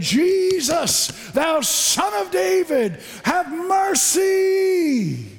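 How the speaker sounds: high, very slow shouted plea; preacher shouting a beggar's plea; crying out. Retold or imitated. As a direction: cry out to Jesus with everything. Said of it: "and saying".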